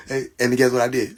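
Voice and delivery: ordinary talk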